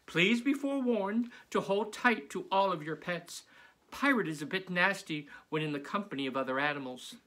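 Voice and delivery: bubbly teacher announcing surprises. Acted. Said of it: "Missus Zappi continued".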